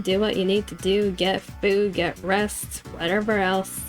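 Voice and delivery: ordinary talk, nothing marked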